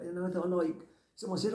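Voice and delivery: usual speaking voice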